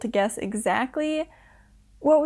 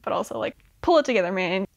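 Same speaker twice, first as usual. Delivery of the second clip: usual speaking voice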